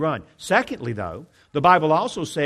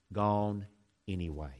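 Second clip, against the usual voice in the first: sinking to a hush; 6 semitones lower, 9 dB quieter than usual. Retold or imitated. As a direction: let the words sink low and soft. Son's closing words, voice sinking.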